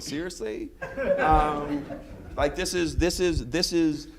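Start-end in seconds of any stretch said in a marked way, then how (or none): 0.0-0.7 incredulous. Retold or imitated. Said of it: "folks are looking around going".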